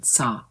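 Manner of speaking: "sa" mouth left open; "sa" open, no glide; correct open saw. Shown as a demonstration. Teacher models the fix. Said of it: "leave the mouth open".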